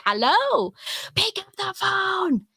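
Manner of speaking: calling out high and slow into an imagined phone; hammed-up plea for someone to answer; mock phone call. To Steven, pretending to ring him: high, slow mock call.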